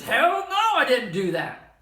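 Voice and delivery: loud, high acted outburst; angry denial. Interviewee denying an accusation, angry acted denial. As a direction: explode in mock outrage, Hell no drawn out. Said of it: "getting really emotional or angry".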